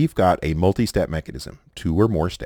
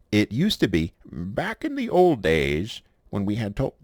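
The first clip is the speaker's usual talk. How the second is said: put-on old man voice; 4 semitones higher than usual. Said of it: "I'm doing my old man voice".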